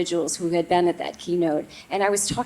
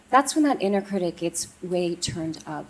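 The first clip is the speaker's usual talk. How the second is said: ordinary talk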